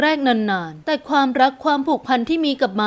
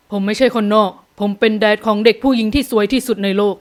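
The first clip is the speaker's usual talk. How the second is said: put-on young man answering; 3 semitones lower, 3 dB louder than usual. A narrator's reading. Father answering mother's objection, firm male voice. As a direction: lower male character voice. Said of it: "ชายหนุ่มกล่าวตอบนีรนาถ".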